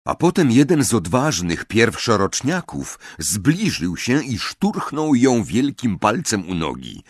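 usual speaking voice

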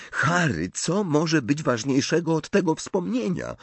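high, incredulous question; astonished. A narrator's reading. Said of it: "zdumiała się Hermiona". (ze zdumieniem, wysokim głosem)

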